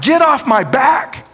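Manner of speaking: high, loud and slow; defiant cry to God. Acted. Job shouting at God to leave him alone, high and slow. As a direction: hurl it up at God. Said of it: "He's telling God".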